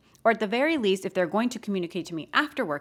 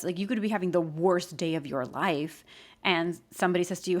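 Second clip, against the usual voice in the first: ordinary talk, nothing marked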